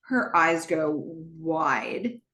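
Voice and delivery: usual speaking voice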